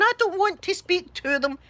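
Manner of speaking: dad's reluctant protest, acted out. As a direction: object in an indignant retold voice. Dad retold, refusing the phone.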